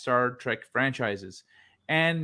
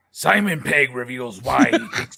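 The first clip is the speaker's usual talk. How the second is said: movie trailer voice; 4 dB louder than usual. Acted. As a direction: read it like an epic movie trailer. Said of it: "That sounded just like the trailer guy".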